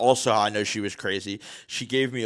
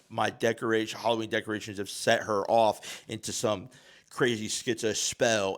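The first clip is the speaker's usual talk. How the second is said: ordinary delivery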